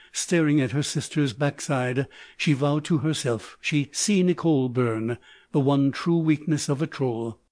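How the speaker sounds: plain reading voice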